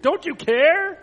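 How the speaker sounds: high, loud, rising acted cry; shrieked reproach. A narrator's reading. Frightened friends challenging Jesus, shrieked. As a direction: cry it out as a desperate question. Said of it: "they shrieked".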